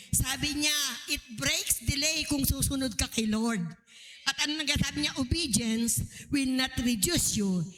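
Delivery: loud, slow preacher's refrain; sermon declaration over music; preaching at full force. Acted. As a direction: drive the slogan home to the congregation.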